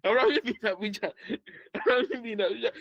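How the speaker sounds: high, quick words through laughter; giggling too much to get it out; laughing while talking. Real, not acted. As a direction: crack up at your own joke mid-sentence. Cracks up at own joke.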